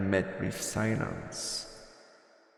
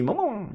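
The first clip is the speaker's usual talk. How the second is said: squeaky high creature "mo mo mo"; high-pitched little critter sounds; cute creature chirp; 12 semitones higher than usual. Pretend. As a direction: chirp it like a tiny pet creature. Creature noise in game.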